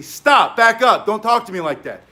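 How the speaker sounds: loud assertive shout; firm loud command. Shown as a demonstration. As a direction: bark it out to set a boundary. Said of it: "you put your hands up and you say".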